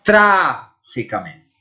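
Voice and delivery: Trágicamente said slowly in Spanish, stress on the first syllable TRÁ; Spanish pronunciation. Shown as a demonstration. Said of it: "Pense nessas palavras". (Trágicamente pronunciado devagar em espanhol, com tônica no TRÁ)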